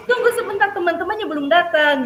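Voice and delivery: suara guru meninggi dan lebih keras; nada tinggi. Retold or imitated. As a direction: menahan anak dengan nada tinggi. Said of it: "nadanya sudah tinggi". (guru menyuruh menunggu dengan nada meninggi)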